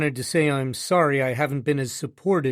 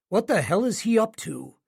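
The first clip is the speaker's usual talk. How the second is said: ordinary delivery, nothing marked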